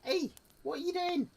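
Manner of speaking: very high-pitched mock Scouse; Scouse accent. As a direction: squeak it out in a caricature Scouse accent. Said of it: "like a Scouse accent".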